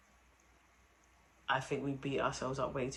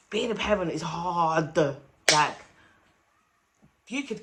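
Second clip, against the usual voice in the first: loud, raised-pitch cry of frustration; exasperated outburst; 4 semitones higher, 12 dB louder than usual. Real, not acted. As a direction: burst out with it, far louder. Exasperated outburst about parenting struggles.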